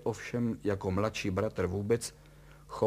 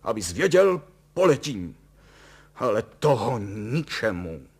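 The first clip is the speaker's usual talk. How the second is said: loud, raised, defiant declaration; combative; 2 semitones higher, 9 dB louder than usual. A narrator's reading. Father vowing to fly after the scoundrel, combative. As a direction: throw it out loudly, spoiling for a fight. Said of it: "Děl bojovně".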